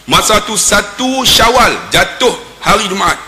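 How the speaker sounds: ordinary delivery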